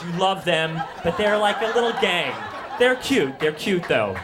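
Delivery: usual speaking voice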